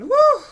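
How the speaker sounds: high, loud "whew"; swooning whoop. Real, not acted. Fan admiring that man, loud swooning whew. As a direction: whoop it out, smitten. Swooning over Mister Russell.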